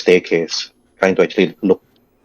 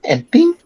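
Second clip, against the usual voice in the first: very high ting, rising; high dripping ting. Shown as a demonstration. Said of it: "you hear ting".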